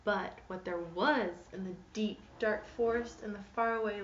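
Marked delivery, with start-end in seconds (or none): none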